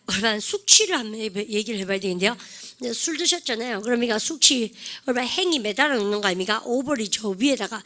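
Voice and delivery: usual speaking voice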